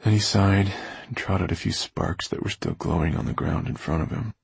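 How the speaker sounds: plain reading voice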